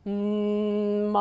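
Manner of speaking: slow hum opening onto ma. Shown as a demonstration. Coach demonstrating the hum into the vowel, slow demo. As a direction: hum then open the mouth to ah. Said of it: "So we're gonna go".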